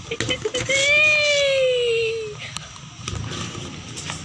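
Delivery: high, long maniacal villain laugh; drawn-out crazy villain cackle; evil cackle. Acted. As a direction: cackle like a mad baddie. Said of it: "He's crazy".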